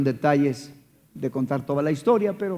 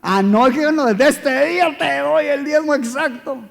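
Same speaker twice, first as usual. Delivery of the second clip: high, loud, flustered vow; hasty promise; 9 semitones higher, 4 dB louder than usual. Retold or imitated. Preacher promising God he'll pay the exact tithe. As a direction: blurt it out fast, scrambling to promise. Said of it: "si no me sale más caro".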